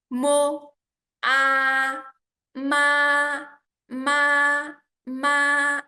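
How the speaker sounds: "ma" held long, high and level, loud; first tone. Shown as a demonstration. Teacher drilling first tone with learners, "ma" high and level. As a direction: spell out "么", "Ah", then sustain "ma" high and steady. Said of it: "医生".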